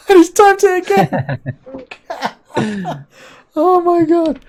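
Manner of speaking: high, laughing disbelief. Real, not acted. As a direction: laugh through the words. Laughing at troll.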